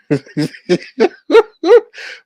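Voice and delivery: a loud, high burst of laughter; high cackling laugh. Real, not acted. Laughing at her disappearing.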